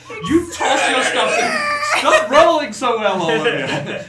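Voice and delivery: high, loud, drawn-out cry of disbelief; excited game master. Real, not acted. Game master hailing a player's lucky roll, loud laughing excitement. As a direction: shout it out, half laughing, stretching the words.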